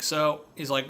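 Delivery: normal talking voice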